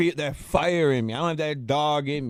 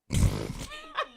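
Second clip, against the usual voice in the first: high laugh; 4 dB quieter than usual. Real, not acted. Guest laughs at sign-off.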